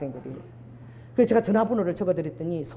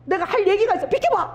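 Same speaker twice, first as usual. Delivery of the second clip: much higher frantic yell; pushy shout; 12 semitones higher than usual. Retold or imitated. Weeping woman shoving past a passenger, frantic high yell. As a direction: shriek at them to move aside. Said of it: "그러고 막 그 사람 손을 막 잡아 빼는 거야".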